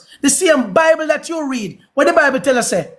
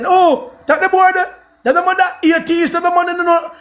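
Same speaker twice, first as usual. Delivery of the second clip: high-pitched imitation of scandalised accusers; mocking critics; 6 semitones higher than usual. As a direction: mimic their fussy outcry in a raised voice. Mimics critics calling him atheist.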